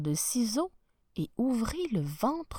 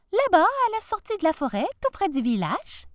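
put-on little girl voice; 10 semitones higher, 5 dB louder than usual.

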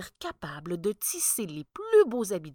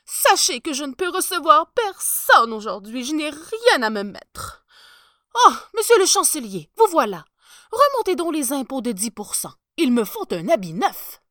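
much higher, much louder pompous emperor; a spoiled ruler who wants everything now; haughty emperor booming out his orders; vain emperor; 7 semitones higher, 13 dB louder than usual. Acted. Spoiled emperor demands clothes.

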